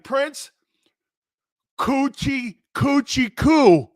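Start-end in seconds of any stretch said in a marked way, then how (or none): 1.8-3.9 "Coochie coochie coo" drawn out slowly and loud, each syllable separate; sounding it out slowly. Shown as a demonstration. Host sounds out a name for co-hosts, slow and loud. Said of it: "am I sounding it out right".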